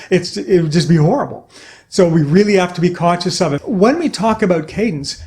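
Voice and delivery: ordinary talk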